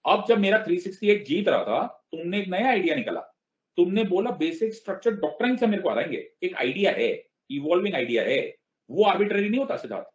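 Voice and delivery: high, heated scolding, sped up; a retold leader snapping at her student; mock angry madam. Indignant Prime Minister scolding Siddharth, high and quick.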